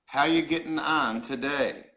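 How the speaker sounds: "How you getting on today" spelled out slowly; "How you getting on today", unhurried, each word separated; slow explanation. Shown as a demonstration. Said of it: "What that means is".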